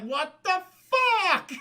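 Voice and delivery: loud, high, drawn-out shout; hammed-up anguished outburst; mock outraged yell. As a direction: bellow it slowly in disbelief. Acting Goliath's outrage.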